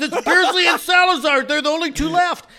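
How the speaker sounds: high, loud retold announcement; excited race update. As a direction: relay astonishing news to the room. Retold race relay, tension rising.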